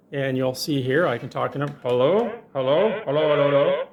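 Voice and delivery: ordinary delivery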